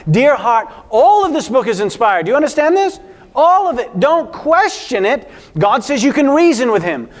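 high, loud pleading, slowing on "Don't question it"; fervent appeal. Real, not acted. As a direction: press it home on the congregation. Preacher earnestly exhorting the congregation.

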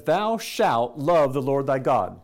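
slow, solemn scripture read. Shown as a demonstration. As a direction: intone the old words slowly. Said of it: "a command in Scripture that says".

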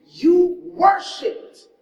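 "You worshiped" drawn out very slowly, high and loud; emphatic climax. Real, not acted. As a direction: lean hard on each syllable. Sermon climax.

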